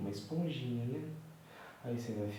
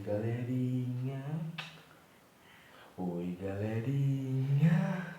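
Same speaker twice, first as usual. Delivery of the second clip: put-on slow, drawn-out, louder hello for the baby; baby greeting voice; 4 semitones higher, 5 dB louder, much slower than usual. Father voicing the baby's hello to viewers, drawn out. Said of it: "Fala oi pra galerinha".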